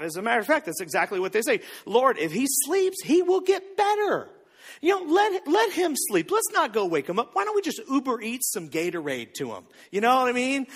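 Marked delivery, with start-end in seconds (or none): 1.9-9.6 mock naive disciples. Mocking the disciples' excuses.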